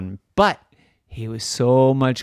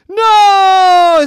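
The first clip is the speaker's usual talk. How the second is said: very loud, very high shout of no; everyone shouts no; 14 dB louder than usual. Acted. Everybody stops the boy opening the door, shouting. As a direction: yell to stop him at once. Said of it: "said everybody".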